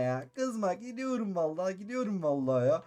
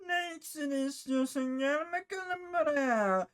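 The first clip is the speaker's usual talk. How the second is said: higher, slow creaky potion-seller; witch voice; 7 semitones higher, much slower than usual. Acted. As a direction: drawl like an old witch luring him in. Potion seller character speaks. (yavaş ve ince cadı sesi)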